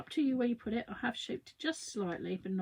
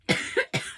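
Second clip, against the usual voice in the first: sharp unvoiced cough; 7 dB louder than usual. Real, not acted. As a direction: cough into the mic. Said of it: "I'm coughing".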